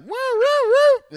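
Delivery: high loud siren imitation; police siren. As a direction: wail like a cop siren. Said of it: "it'll be like".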